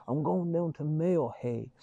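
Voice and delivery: put-on light Mayo accent with a "hey" tacked on the end; playful touch of a Mayo accent; mock Mayo accent. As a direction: lightly imitate a Mayo local. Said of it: "they also say hey at the end of a sentence".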